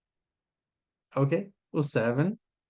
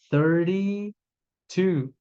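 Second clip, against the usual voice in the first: "Thirty-two" said very slowly, flapped T; very slow model; 3 semitones higher, much slower than usual. Shown as a demonstration. Teacher modelling an age answer slowly. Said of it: "Aí você falaria".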